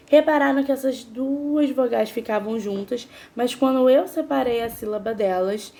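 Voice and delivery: normal talking voice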